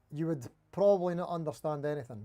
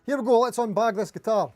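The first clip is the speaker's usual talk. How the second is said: loud, eager kickoff; bursting to open the bag; excited; 4 semitones higher, 9 dB louder than usual. Real, not acted. Starting the guitar unboxing.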